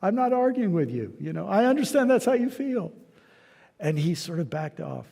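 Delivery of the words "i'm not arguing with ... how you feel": higher pitched, pleading to calm him down; soothing an angry buddy, voice lifted higher; calming placation. Retold or imitated. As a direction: hands up, easy now, don't fight me.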